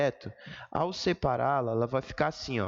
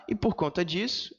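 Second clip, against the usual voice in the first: ordinary talk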